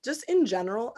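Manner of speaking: ordinary talk, nothing marked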